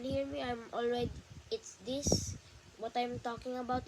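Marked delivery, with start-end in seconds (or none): none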